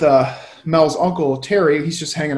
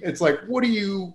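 ordinary delivery

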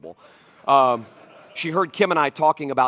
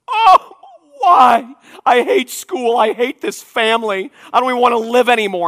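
put-on high, loud melodramatic teen wail; whiny daughter tantrum; 11 semitones higher, 14 dB louder than usual. Dad mimics his daughter's teenage meltdown, high whiny wail.

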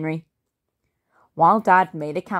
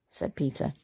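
ordinary delivery, nothing marked

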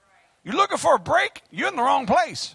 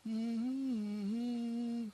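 mock limp preacher; 15 dB quieter than usual. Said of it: "instead of being a, you know".

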